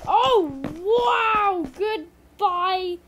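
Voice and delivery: loud, high-pitched startled outburst; startled yell. Real, not acted. Gamer fleeing something terrifying, startled yell. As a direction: belt it out as you bail.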